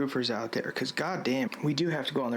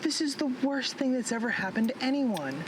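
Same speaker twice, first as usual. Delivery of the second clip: melodramatic; 9 semitones higher than usual. Real, not acted. Melodramatic complaint on roof.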